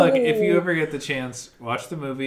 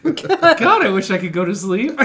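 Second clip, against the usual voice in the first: put-on whiny scared kid; 8 semitones higher, 10 dB louder than usual. Mimicking scared child, laughing.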